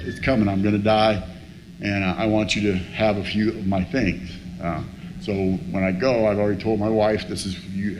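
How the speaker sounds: low, subdued voice of a dying friend; grave resignation. Retold or imitated. Dying friend hands on his things, grave resignation. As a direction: say it low and soft, accepting it.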